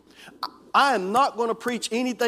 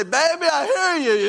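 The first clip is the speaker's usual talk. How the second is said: much higher, louder, slow reply; answering call; 10 semitones higher, 6 dB louder than usual. Pretend. Husband answers his wife in the hallway, high and slow. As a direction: call back so she knows you heard. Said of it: "And I'm gonna say".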